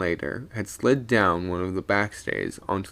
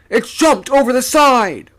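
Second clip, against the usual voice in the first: loud, high alarmed cry; alarmed shout; 12 dB louder than usual. A narrator's reading. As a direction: shout it in panic.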